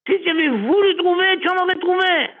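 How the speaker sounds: pitched well up throughout; high preaching rebuke. Retold or imitated. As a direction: lecture him from up high. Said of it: "Abraham a dit à Eliezer".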